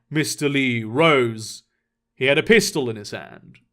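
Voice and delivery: ordinary delivery, nothing marked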